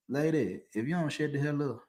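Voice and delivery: quiet menace through the teeth. Retold or imitated. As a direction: mutter it like a last warning. Retold warning to wife.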